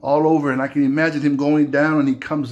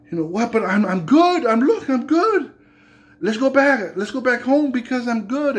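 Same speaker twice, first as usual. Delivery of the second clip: higher, breezy dismissal; dismissive brush-off; 9 semitones higher than usual. Acted. Naaman to his servant, breezily insisting he is fine. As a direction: wave it off, pleased with himself.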